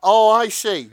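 mock realization. Real, not acted.

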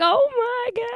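mock dismay at the screen; a fan's hammed-up shock; shocked gasp. Retells own shocked reaction.

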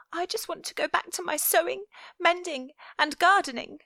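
put-on high, fast pleading little girl; young girl voice. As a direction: rush it out like a homesick child. Viola wishes for home.

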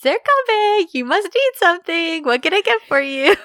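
high, loud singsong mock fussing; hammed-up, as if cooing to a little kid; patronizing. Assistant offering to get Serkan Bey something, high and patronizing. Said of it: "it's, like, patronizing".

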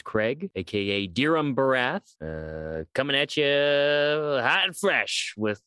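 a hint of a hyped sports announcer; lightly playing the radio DJ; radio host voice. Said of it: "That's like a sports announcer".

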